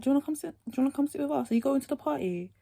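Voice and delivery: bright, high eager asking; high sweet questions. Retold or imitated. Asking the boy about the party, high and eager.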